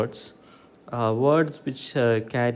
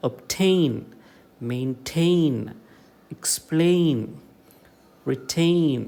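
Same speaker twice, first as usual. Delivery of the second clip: obtain, maintain, explain, retain with TAIN, TAIN, PLAIN, TAIN raised; obtain, maintain, explain, retain, higher on each stressed ending; stress on second syllable; 3 semitones higher, much slower than usual. Shown as a demonstration. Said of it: "falls here on the second syllable".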